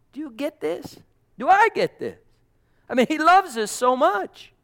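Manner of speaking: high-pitched, building to loud fervor; fervent preaching. Acted. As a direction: preach it in rising amazement. Preaches at full force.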